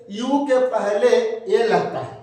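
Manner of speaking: drawn out, word by word; slow emphatic rule. Shown as a demonstration. Teacher slowly stressing the rule to pupils. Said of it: "drawn-out teaching rule".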